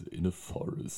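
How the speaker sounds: whispered, eerie trailer narrator; horror trailer voice. Retold or imitated. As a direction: breathe it like a spooky trailer opening. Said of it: "diese Trailer-Stimme am Anfang richtig weird".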